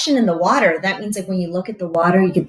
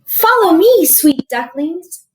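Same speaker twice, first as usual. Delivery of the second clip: much higher, louder call that slows on "sweet ducklings"; mother duck calling; 7 semitones higher, 6 dB louder than usual. Acted. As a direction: call the chicks to follow you to the pond.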